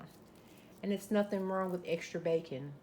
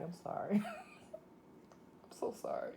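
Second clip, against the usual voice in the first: breathless giggly apology; laughing; 4 semitones higher, 9 dB quieter, slower than usual. Real, not acted. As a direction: laugh it out, barely voiced.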